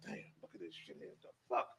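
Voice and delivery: whispered disbelief, quickly; stunned hushed aside. Retold or imitated. Officer to himself watching a grown man cry, whispering in disbelief. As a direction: say it under your breath, barely voiced.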